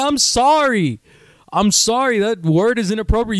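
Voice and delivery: high-pitched offended sorry; indignant mock apology. Acted indignant reply to insult.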